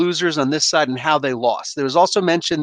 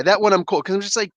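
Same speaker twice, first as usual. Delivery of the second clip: ordinary delivery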